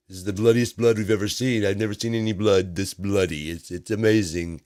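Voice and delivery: a hint of a bragging president; mock Trump boast. Mocking Trump bragging about his blood test, lightly hammed-up.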